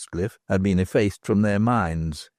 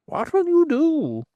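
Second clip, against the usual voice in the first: much higher, cackling crone voice; a knowing old beldam; old hag's voice; slower than usual. Acted.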